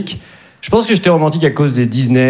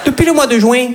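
put-on high, laughing Midi accent in a New York romcom; southern French accent; 7 semitones higher than usual. Comic imagining a New York romcom dubbed with the Midi accent, high laughing Midi accent. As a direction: play a Marseille-accented New Yorker, giggling. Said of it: "une comédie romantique à New York avec l'accent du Midi".

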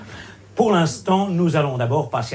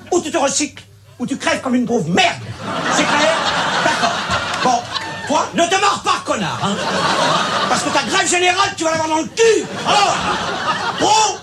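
screaming much higher and louder, fully losing it; furious shout; 8 dB louder than usual. Acted. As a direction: blow up and yell them all down. Mediator finally snaps and screams.

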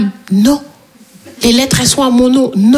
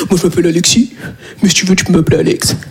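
much lower, smug show-off voice; mocking smug guy; 8 semitones lower than usual. Comedian imitating a guy introducing himself with a nickname, smug and low. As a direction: deepen it like a guy who thinks he's cool. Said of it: "il a dit".

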